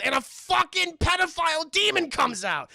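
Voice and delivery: loud, high-pitched dramatic announcement; worked-up storyteller's shock; hyped reveal. Real, not acted.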